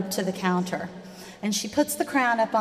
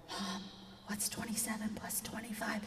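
whispered question to mom; unvoiced child whisper asking mom for help; 21 dB quieter than usual. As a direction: lean in and whisper the sum.

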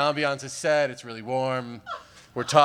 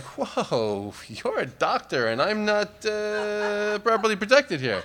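slightly higher, hesitant protest; nervous caution; 7 semitones higher, slower than usual. Retold or imitated. Comic quoting his nervous protest to the doctor, slightly higher. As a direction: stall her nervously, half joking. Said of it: "and I was like".

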